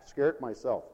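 ordinary talk

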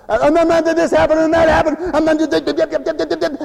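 high, fast mocking gossip; mock chatter. Preacher mimics people chattering to drown conscience, high and fast. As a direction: rattle it off high like noisy chatter. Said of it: "talk back and forth".

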